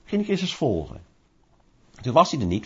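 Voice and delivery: ordinary talk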